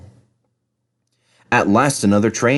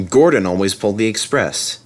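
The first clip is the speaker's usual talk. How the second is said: plain reading voice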